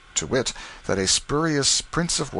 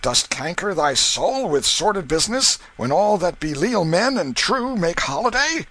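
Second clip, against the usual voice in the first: tipsy burly waterman; 11 semitones higher, 3 dB louder than usual. Acted. A drunken waterman stopping Canty in the crowd, jovial and high. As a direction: hail him like a merry drunk.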